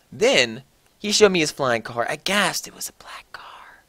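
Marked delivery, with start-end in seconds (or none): 2.7-3.8 breathless hushed whisper. Acted. Narrator revealing the car, in an awed whisper. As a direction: whisper it like a big secret. Whispered aside after gasp.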